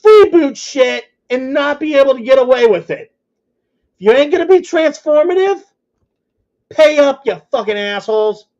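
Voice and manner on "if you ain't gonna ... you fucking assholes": heated, raised voice calling them out; angry rant. Real, not acted. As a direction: shout it at the thieves, fed up. Ranting at copyright thieves.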